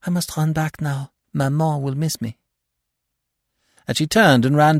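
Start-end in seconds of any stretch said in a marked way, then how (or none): 0.1-2.4 higher, lightly voiced girl; young girl. A narrator's reading. Girl takes her leave of the detective, in a higher voice. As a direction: lift the voice into a girl's.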